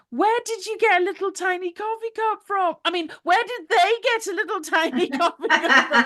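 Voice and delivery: put-on a little girl letting the secret slip; imitating her daughter. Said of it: "'cause she goes".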